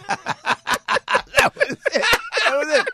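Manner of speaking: high, breathy laughter through the words; laughing. Real, not acted. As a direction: laugh it out, barely able to speak. Laughing at the Hoboken joke.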